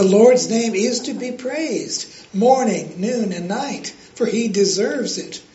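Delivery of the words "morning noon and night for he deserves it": normal talking voice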